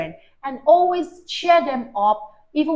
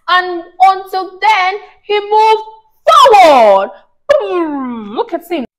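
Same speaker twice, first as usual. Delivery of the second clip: louder, higher building excitement; excited climax; 7 semitones higher, 10 dB louder, slower than usual. A narrator's reading. Mother reading the race finish to her child, excited.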